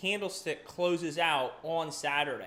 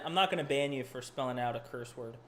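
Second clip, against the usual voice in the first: normal talking voice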